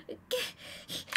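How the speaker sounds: usual speaking voice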